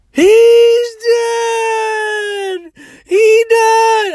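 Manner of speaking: very high, loud drawn-out wail; hysterical crying. Retold or imitated. Devastated woman announces a death to her driver, mock wailing. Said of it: "She's crying".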